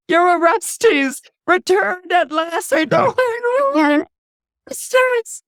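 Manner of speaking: high, loud, tearful cry of recognition; overjoyed cry. Acted. Electra recognising her returned brother, crying out in tearful joy. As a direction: cry out his name, half sobbing with joy.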